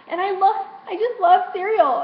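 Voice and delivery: high exasperated wail. Real, not acted. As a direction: voice climbing with craving. Tearful rant about missing foods.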